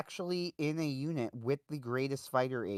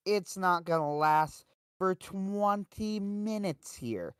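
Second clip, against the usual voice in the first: higher, slow grim warning; ominous mechanic; 6 semitones higher, slower than usual. Retold or imitated. Mechanic warning the new pilot, slow and ominous. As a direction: drag it out like a doomsday prophecy. Mechanic warns Dahl about planes.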